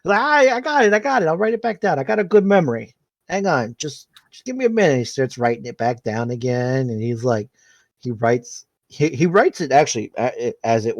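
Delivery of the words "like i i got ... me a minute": flustered. Acted. Vinny flustered after spilling beer.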